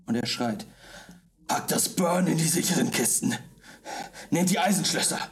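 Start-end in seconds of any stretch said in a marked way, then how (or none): 1.5-5.2 throaty bellowing orders, pitched up; deep rasping growl. Acted. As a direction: bark orders in a rough shout. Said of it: "ihr hört Abacus kehlige Stimmen".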